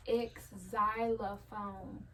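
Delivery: X xylophone drawn out slowly, X said apart; sounded out slowly. Shown as a demonstration. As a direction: stretch out X xylophone. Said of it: "this X is like".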